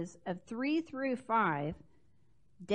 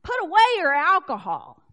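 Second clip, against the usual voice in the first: scolding priest; 5 semitones higher, 9 dB louder than usual. Acted. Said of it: "And he says".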